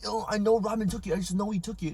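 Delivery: put-on whiny accusing voice; mock accusing kid. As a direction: imitate an upset kid insisting. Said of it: "so William's like".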